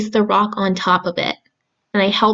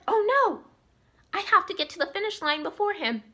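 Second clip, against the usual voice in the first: high, fast panicky hare voice; goofy muffled sock puppet; 9 semitones higher, 12 dB quieter, faster than usual. Acted. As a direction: squeal it out like a startled bunny.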